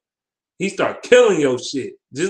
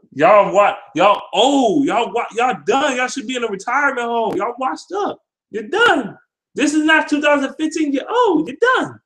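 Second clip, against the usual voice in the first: rising taunt; 10 semitones higher than usual. Real, not acted. Taunting rival fan, voice climbing.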